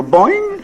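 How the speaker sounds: high, drawn-out cartoon boing; springy "boing". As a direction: imitate ribs springing back, pitch shooting up. Imitates ribs springing back.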